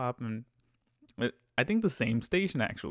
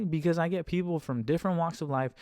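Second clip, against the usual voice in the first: usual speaking voice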